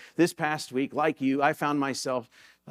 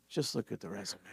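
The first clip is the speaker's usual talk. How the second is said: much softer, calm and simple; 6 semitones lower, 12 dB quieter, faster than usual. Acted. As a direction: say it gently, no worry at all. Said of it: "He very calmly simply says".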